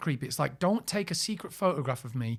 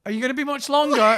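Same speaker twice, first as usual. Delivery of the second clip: impatient call through door; 9 semitones higher, 9 dB louder than usual. Retold or imitated. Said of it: "fan asking through the door".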